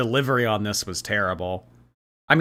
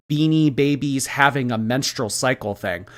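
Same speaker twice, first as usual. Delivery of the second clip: ordinary delivery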